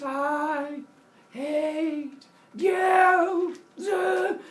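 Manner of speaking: high, loud drawn-out shout; mock yell. Leto running past Olympus, yelling at Zeus. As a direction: holler upward, stretching each word. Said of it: "she yells up at Zeus".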